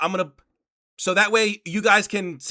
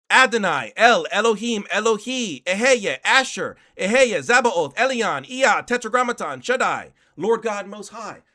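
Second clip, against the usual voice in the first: reciting names; 4 dB louder than usual. Acted. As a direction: intone each name like a chant.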